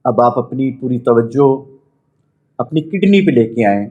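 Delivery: measured meditation voice with long pauses; guided meditation. Acted. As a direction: guide them inward, phrase by phrase. Leads a chakra meditation.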